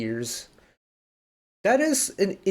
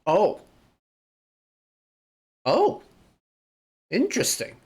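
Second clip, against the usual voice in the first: surprised; 7 semitones higher than usual. Real, not acted. Realises the outro mistake.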